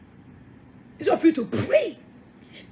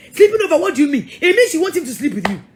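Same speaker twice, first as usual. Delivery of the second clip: louder, sharp disbelieving question; indignant challenge; 6 dB louder, faster than usual. Real, not acted. Preacher confronting women who sleep over, loud indignant scorn. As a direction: call out the excuse with scorn.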